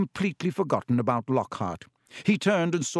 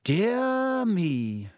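lightly voiced old wizard, a bit slower; Dumbledore, gently teasing; 8 semitones higher than usual. A narrator's reading. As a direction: tease with a twinkle.

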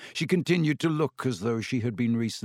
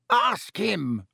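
shrieked; 6 semitones higher than usual. A narrator's reading. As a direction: fling the blame in a shriek. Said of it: "shrieked Filch".

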